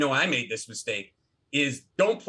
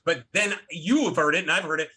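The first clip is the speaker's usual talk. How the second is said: normal talking voice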